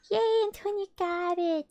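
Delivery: high, delighted yay after a gasp; excited cheer. Real, not acted. Cheering Antonia for getting it, excited. Cheering someone who got it.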